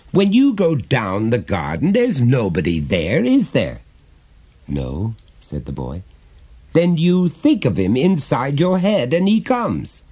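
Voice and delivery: a hint of a chummy dad prying gently; jovial father. Father coaxing his small son to confide, lightly voiced.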